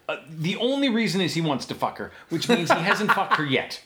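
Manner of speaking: usual speaking voice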